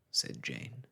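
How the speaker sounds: usual speaking voice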